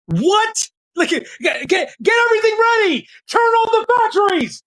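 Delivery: mock excited shout. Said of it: "They were like".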